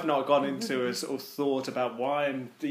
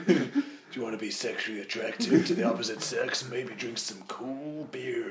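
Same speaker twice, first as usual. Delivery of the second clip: lower, softer seductive ad voice, slowing at the end; sleazy ad voiceover; 4 semitones lower, 5 dB quieter than usual. Pretend. Selling beer to lonely men, sultry mock ad voice. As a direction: purr it like a cheesy aftershave advert.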